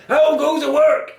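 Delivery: very high, loud farmer's shout; calling farmer. A narrator's reading. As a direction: shout it as the farmer just woken. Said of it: "he called".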